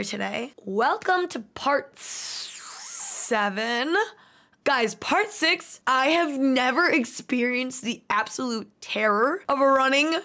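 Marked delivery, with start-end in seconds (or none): none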